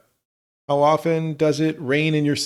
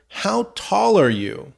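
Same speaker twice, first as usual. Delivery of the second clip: slow repeat; 7 semitones higher, slower than usual. Shown as a demonstration. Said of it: "I think he's asking her".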